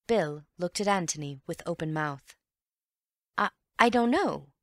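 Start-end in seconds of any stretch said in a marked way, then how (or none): 3.4-4.5 higher, louder, stumbling I, I don't know; startled stammer, much higher. Acted. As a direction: trip over the first I in shock. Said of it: "he stammered".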